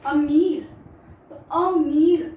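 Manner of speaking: अमीर with the ई drawn out long and loud; stretched long ee. Shown as a demonstration. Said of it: "ई की मात्रा की sound ज्यादा आ रही है". (लंबी ई पर ज़ोर देकर अमीर)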